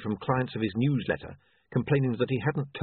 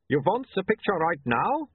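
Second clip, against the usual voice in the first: put-on light Uberwaldian accent, raised pitch; a hint of an Uberwaldian photographer; lightly voiced Uberwaldian accent; 8 semitones higher than usual. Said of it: "don't make fun of his accent".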